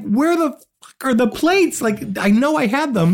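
high, slow, exasperated; high slow exasperation over missing plates; frustrated searching. Retold or imitated. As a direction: drag it out, baffled and desperate. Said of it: "all this time I've been like".

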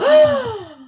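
very high, loud staged gasp; a big theatrical gasp of shock; mock gasp. Turning on the peeping client, staged gasp. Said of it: "I'll be like".

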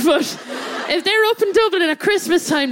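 ordinary talk, nothing marked

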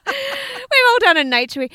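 high, loud, laughing through the words; helpless laughing delivery. Real, not acted. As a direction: crack up while saying it. Laughing along at co-host's poo joke.

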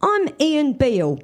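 lightly voiced cockney neighbour; a hint of a chummy bloke over the fence; Ian Beale's voice. A narrator's reading. Ian Beale greets his new neighbour over the fence, lightly voiced. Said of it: "he said".

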